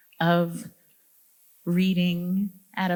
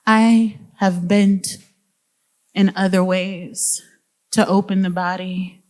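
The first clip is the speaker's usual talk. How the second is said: slow, measured poem recital with weighted pauses; poetry reading; 7 dB louder than usual. Acted. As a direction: read it as verse, slow and deliberate, lingering on key words. Reads her poem aloud.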